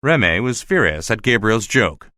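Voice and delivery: ordinary delivery, nothing marked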